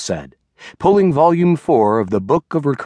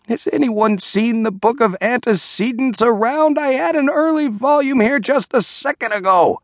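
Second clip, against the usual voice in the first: higher, fussing and embarrassed; flustered rabbi; 7 semitones higher than usual. A narrator's reading. Diddle losing his place before the congregation, flustered. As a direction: hunt for the lost page aloud.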